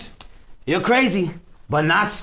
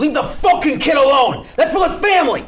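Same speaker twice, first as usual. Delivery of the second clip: loud, high furious yell; raging shout; 9 semitones higher, 6 dB louder than usual. Acted. Al to the chef beating Larry: furious shout. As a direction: explode at the chef in fury. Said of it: "Al suddenly goes into a rage".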